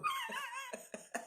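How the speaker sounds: a quick high burst of laughter. Real, not acted. A creator laughing at her own unbothered joke, high laugh.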